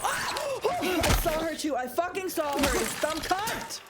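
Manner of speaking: gasping, then yelling high and loud in fright; screaming at the monster in real panic. Real, not acted. Startled streamer screaming at the game's monster, loud and high. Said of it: "from screaming".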